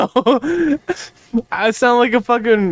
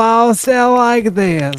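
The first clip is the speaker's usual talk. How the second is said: put-on southern accent; 3 semitones higher than usual. Showing friends the Merle voice, southern accent. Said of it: "you sound like Merle".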